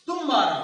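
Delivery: तुम्हारा punched loudly on तुम; stress on first syllable. Shown as a demonstration. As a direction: lean hard into the तुम that opens तुम्हारा. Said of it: "जोर पड़ा ना तू के ऊपर". (तुम्हारा में तुम पर ज़ोरदार बलाघात)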